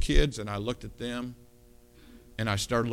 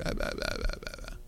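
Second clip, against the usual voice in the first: dismissive blah blah; 7 dB quieter than usual. Retold or imitated. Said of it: "I told them all the way home".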